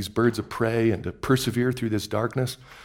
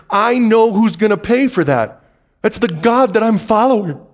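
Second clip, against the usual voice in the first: high, loud, eager cry of recognition; rising excited shout; excited realization; 9 semitones higher, 6 dB louder than usual. Retold or imitated. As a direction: voice the Israelites' thrilled discovery. Israelites realize, excited exclamation.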